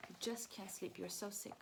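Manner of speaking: ordinary delivery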